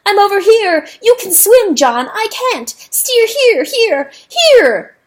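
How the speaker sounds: frantic cries. Acted. Rooster screaming for rescue, frantic and loud.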